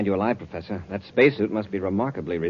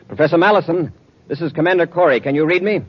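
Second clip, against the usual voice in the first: louder, higher call over the radio; 5 semitones higher, 4 dB louder than usual. Acted. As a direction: hail him across the distance, loud and clear. Calls the stranded professor by radio.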